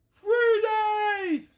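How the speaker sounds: high, loud SpongeBob yell; SpongeBob quote shout. Retold or imitated. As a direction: shriek it like the narrator fish. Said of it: "SpongeBob reference".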